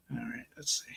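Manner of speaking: hushed whisper while working; quiet unvoiced aside. Real, not acted. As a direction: breathe it under your voice. Whispering while handling the coin.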